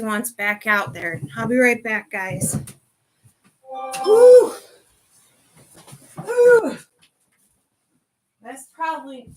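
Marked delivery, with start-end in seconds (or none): 4.1-6.8 very high, loud surprised "ooh". Real, not acted. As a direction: squeal out a startled ooh. Loud reaction while away.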